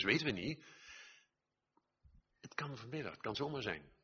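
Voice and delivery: ordinary talk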